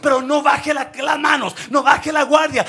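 loud, high-pitched preacher's shout; fiery preaching. Acted. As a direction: preach it at full force to the church. Preaching at full force. (predicación a todo pulmón, alta y fuerte)

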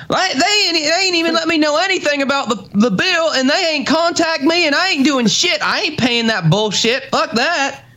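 high-pitched Southern twang, worked-up rant; upset Southern twang. Acted. Showing a co-host the accent when upset, Southern twang rant. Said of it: "especially when I get upset".

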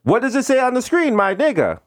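high, sing-song mock Indian accent; Indian call-center agent. Agent asking caller about screen, high Indian accent.

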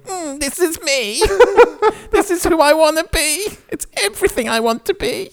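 put-on dreamy fat kid. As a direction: swoon like a kid reading his hero. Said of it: "the fat kid reading this originally going".

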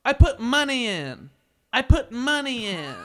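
high, loud, drawn-out heckler's whine; mock a tipsy customer screaming her demand slowly; imitated drunken screaming. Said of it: "She kept screaming it at us".

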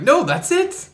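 very high, loud squeaky answer; playful squeak; mock reply. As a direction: toss it off in a silly high voice. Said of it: "I was like".

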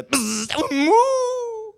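high, loud moo after the buzz; mock cow moo. Preacher acting the cow feeling the prod. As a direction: squeal like a prodded cow.

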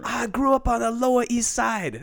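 high put-on caricature voice. Comedian stays in the dated caricature voice. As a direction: keep the hammy caricature going. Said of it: "problematic a Chinese voice".

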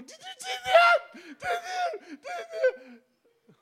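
high, laughing question trailing to a whisper; laughing disbelief. Retold or imitated. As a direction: gasp it out through laughter. Laughing at movie, asking neighbors.

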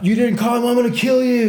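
high, loud imitation of a furious threat; mock angry threat. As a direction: yell it as the imagined angry dealer. Imagined threat from the arrested dealer.

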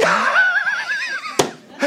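high, loud shrieking cackle; the devil howling with glee; a laugh that peaks in a shout; devil's shrieking laugh. Acted.

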